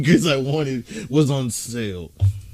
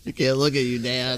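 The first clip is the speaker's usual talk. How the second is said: imitating child; 8 dB quieter than usual. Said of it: "The direct quote from X's daughter".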